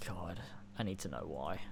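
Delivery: hushed urgent whisper. Real, not acted. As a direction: breathe it out, desperate to know. Whispered aside.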